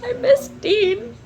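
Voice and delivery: high, slow pining whine; drawn-out lovesick whine; mock whine. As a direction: whimper it like a lovesick fan. Whiny pining for a book character.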